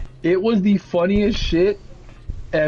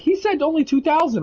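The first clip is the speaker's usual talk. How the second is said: insistent customer; 11 semitones higher than usual. Retold or imitated. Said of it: "then she's asking me".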